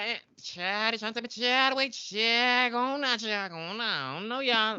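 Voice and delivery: high, put-on sassy auntie voice; sassy old auntie. Mocking Nora shooing Margot away, high and sassy. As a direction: shoo them off like a fed-up auntie. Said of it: "she like".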